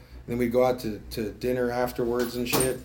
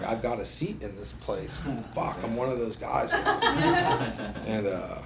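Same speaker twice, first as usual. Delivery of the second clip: ordinary talk, nothing marked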